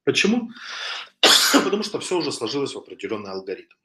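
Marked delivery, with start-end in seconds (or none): 0.7-1.6 a real cough, sick voice. Real, not acted. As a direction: cough once, then apologise. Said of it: "Я прошу прощения за кашель". (кашель простуженного)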